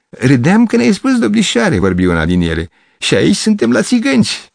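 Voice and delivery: put-on high, laughing girl's voice; mock him like a giggling girl. Girl laughing at Gavrilescu, high and mocking.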